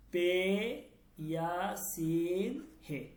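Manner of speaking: পে yeah সিন হে very slow, letter by letter; slow spelling out. Shown as a demonstration. Teacher spelling a word for students, very slowly. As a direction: drag each letter name out for the class. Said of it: "চলেন আমরা এটা দেখি কিভাবে হলো".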